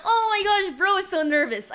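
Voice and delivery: high, laughing and loud. Real, not acted. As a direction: crack up at the screen. Laughing at nervous character.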